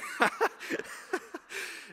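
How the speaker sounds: real high, breaking-up laughter; helpless amused giggling. Real, not acted. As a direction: crack up at your own story. Laughs at his own prank story.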